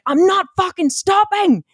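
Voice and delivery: high, slow, emphatic retold outburst; defiant retort. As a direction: re-enact a furious refusal, stretching each word. Retold row with her husband.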